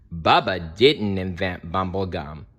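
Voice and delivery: usual speaking voice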